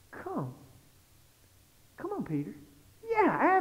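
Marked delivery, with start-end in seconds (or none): 0.1-2.5 soft, unhurried invitation; quiet beckoning. Retold or imitated. Jesus inviting Peter onto the water, quiet beckoning. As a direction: coax someone gently toward you.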